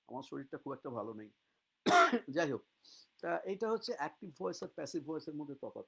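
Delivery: usual speaking voice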